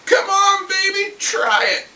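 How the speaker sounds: high, loud taunt, slowing down; taunting the dragon. Retold or imitated. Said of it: "and said".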